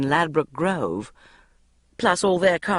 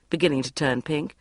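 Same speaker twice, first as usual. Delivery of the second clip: plain reading voice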